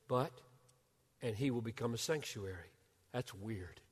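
ordinary talk, nothing marked

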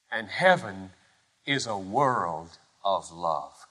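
low, soft, weighted phrases with pauses; hushed solemn preaching. Acted. Preacher naming what binds the hands of love, hushed solemn. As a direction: list it gravely, hushed and measured.

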